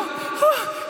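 high, drawn-out scared breaths; acted hyperventilating gasp; panicked gasp. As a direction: gulp air like you're about to jump. Panicking during the jump countdown.

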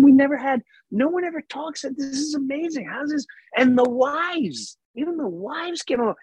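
high-pitched, wonderstruck gush; gushing amazement. Retold or imitated. Telling Basil how amazing the group is, gushing amazement. Retelling own gushing at the table.

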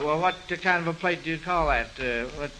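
ordinary talk, nothing marked

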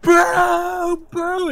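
high, loud, long jeers; wailing heckler shouts; crowd booing. Acted. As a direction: boo the fraud as a whole crowd. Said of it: "And then the crowd".

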